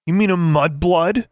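loud, high indignant question; slow, high, incredulous outburst; exclaiming, voice rising. A narrator's reading. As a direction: let the voice climb in outrage. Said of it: "she exclaimed, her voice rising".

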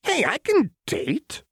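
high, slow and offended; indignant. A narrator's reading. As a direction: protest as if insulted. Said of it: "Jinx said indignantly".

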